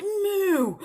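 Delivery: high, loud moo; cow moo. A narrator's reading. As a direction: imitate an alarmed cow. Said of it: "And the cow said".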